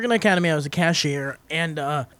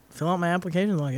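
ordinary talk, nothing marked

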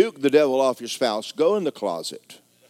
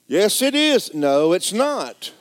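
comic retort; 7 semitones higher, 7 dB louder, slower than usual. Acted. Contradicting own line.

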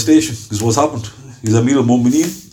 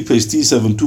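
normal talking voice

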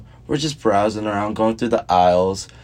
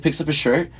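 ordinary talk

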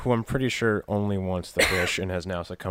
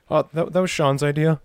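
higher and louder, hammed-up guilty blurt; mock guilty excuse; 8 semitones higher, 9 dB louder than usual. Pretend Sean blurting he is to blame, hammed-up. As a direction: blurt it like a kid caught out.

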